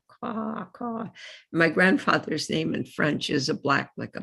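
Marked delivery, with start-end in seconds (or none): 0.1-1.1 soft high crow caw. Retold or imitated. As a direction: imitate a crow cawing softly. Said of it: "a crow".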